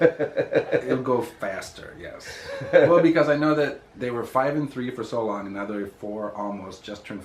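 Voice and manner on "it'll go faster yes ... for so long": laughing loudly through the words; real laughter while answering. Real, not acted. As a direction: laugh it out, caught off guard. Laughing at question about kids' ages.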